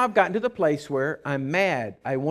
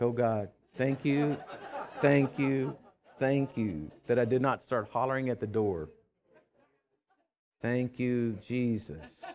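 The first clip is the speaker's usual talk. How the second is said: softer, slower, laughing gratitude; relieved prayer; 5 semitones lower, 7 dB quieter than usual. Retold or imitated. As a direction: breathe out thanks to God. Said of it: "And I was like".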